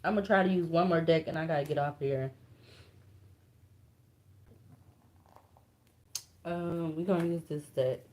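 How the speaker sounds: soft and subdued, fighting real upset; quiet, heavy-hearted, voice sinking away; emotional. Real, not acted. Card reader upset for strangers, emotional and hushed. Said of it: "emotional like this".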